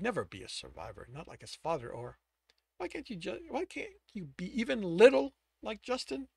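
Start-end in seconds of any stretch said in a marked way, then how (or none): none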